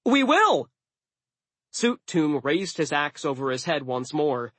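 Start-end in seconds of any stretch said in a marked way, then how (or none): 0.0-0.7 high, loud, gleeful; excited battle-ready cry; happy yell. A narrator's reading. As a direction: shout your eager agreement. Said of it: "Jack yelled happily".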